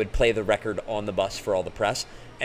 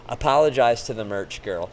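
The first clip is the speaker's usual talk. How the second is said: usual speaking voice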